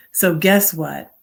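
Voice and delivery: usual speaking voice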